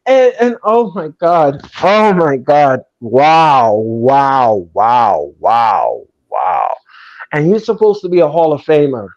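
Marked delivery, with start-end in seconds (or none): 0.1-6.8 each wow dropping lower, sinking into disbelief; stunned disbelief. Real, not acted. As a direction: let every wow fall lower than the last, stunned. Reacting to daughter's purchase.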